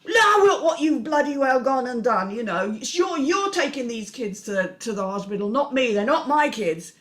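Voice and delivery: high, loud angry stepdad rant; stepfather shouting. Acted. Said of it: "the stepfather's shouting".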